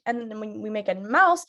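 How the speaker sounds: normal talking voice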